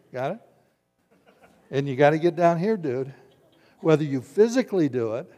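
ordinary talk

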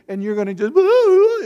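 high squealing ooh as your cheek gets grabbed; a loud, high-pitched cheek-pinch squeal. Retold or imitated. Acting a child whose cheek is grabbed.

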